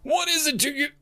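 very high, snappy challenge; shouted retort. A narrator's reading. Dead soldier spins on an unknown voice, shouting. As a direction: whirl round and yell it high. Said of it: "he shouted".

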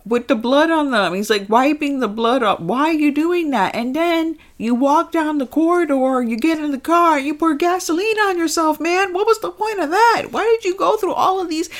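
high-pitched, exasperated questioning; incredulous mock rant. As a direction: climb into a squeaky, baffled scolding. Mock scolding the movie robot, incredulous.

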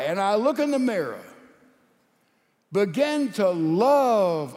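emphatic, drawn-out appeal with big pauses; full-force preaching. Real, not acted. Preacher urges men to love wives, full-force preaching. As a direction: ring out each key phrase to the crowd.

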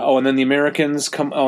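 ordinary talk, nothing marked

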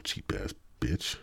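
low, slow, muttered grumble under breath; muttered insult. Real, not acted. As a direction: grumble it to yourself. Grumbling about small tip.

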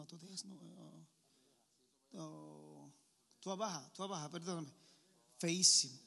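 ordinary delivery

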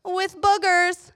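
high, drawn-out teasing; slow, silly high punchline; dad's little voice. Retold or imitated. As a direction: imitate dad's playful high voice, stretched out. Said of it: "he does that little voice".

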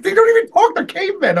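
high, gleeful marvelling; delighted disbelief. Retold or imitated. Fan gushing about a caveman movie, high and gleeful. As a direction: squeal it like a fan. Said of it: "I was like".

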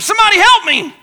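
high, loud cry for help; acted-out desperate shout; scream for help. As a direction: holler it out as if stranded on a cliff. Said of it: "he screamed".

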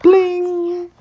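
high sung chime imitation; bright vocal bling; chime sound. Shown as a demonstration. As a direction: ring it out like a bell. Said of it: "when you hear the chimes ring like this".

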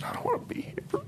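tiny, high, breaking whisper; imitated crying. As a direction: imitate a tearful son, barely voiced and high. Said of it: "he just starts crying".

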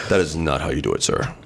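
low, proper newscaster read. Retold or imitated. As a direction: stiff formal reporter delivery.

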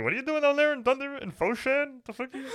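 high-pitched mock manager outrage; mock Michael Scott boss. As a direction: squeak it out like a baffled office boss. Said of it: "He's like Michael Scott".

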